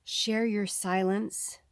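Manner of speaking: slow, soft reading of a card message; slow soft oracle reading. Acted. Reader voicing the card to viewers, slow and soft. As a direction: read it like a gentle oracle speaking.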